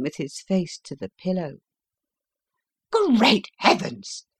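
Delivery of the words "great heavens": higher, slow, amazed exclamation; stunned inspector crying out; astonished cry. A narrator's reading. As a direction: gasp it out in shock. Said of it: "cried the inspector".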